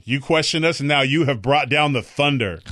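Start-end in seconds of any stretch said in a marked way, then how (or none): none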